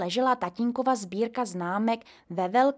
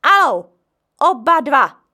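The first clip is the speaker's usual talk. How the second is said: command; 6 semitones higher, 13 dB louder than usual. A narrator's reading. Mother orders them home.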